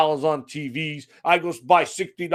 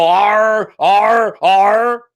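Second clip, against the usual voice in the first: a loud, hammed-up pirate "arr", shouted; mock pirate; 2 semitones higher, 6 dB louder, much slower than usual. Ranting gamer mocks pirate roleplay in Sea of Thieves, loud hammed-up pirate growl. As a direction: growl it like a pantomime pirate, louder. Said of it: "put on a pirate hat and go".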